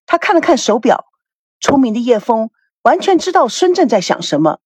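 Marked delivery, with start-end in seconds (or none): none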